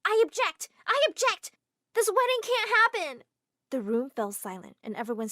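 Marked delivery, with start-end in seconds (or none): 0.0-3.2 high, loud shouting; screamed objection. Acted. Girl screaming her objection in the ballroom. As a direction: scream it at the room. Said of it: "I screamed".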